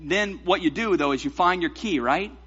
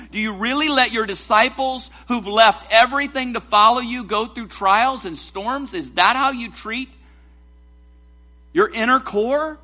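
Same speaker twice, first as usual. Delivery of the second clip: higher, incredulous questioning; indignant challenge; 5 semitones higher, 4 dB louder than usual. Acted. Disciples challenge Jesus over the storm, indignant challenge. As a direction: question it in pained disbelief, pitched up.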